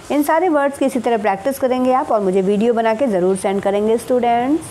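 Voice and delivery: normal talking voice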